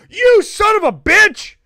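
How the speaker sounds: loud, high hammed-up shouting; mock fury. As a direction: bellow it as if gravely insulted. Mock overreaction to wrong home state.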